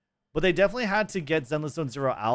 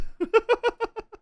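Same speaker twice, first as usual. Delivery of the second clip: high squeaky laugh; 3 dB louder than usual. Real, not acted. High laugh at the meme fix.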